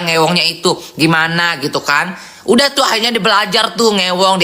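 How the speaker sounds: ordinary talk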